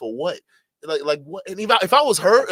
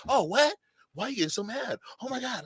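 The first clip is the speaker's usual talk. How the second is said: high, softer mock-shocked voice; mocking mimicry; 6 semitones higher, 5 dB quieter than usual. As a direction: imitate their fake dismay, pitched up. Mimics opponents feigning shock at him.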